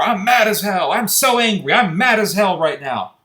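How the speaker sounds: loud, high-pitched imitation of outrage; hammed-up indignant rant; mock angry. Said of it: "talking about how mad they are".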